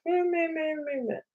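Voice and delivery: high, soft bleating "meh"; meek bleating. Shown as a demonstration. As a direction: bleat like a cowering animal giving in. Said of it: "Or maybe I'm going to fawn".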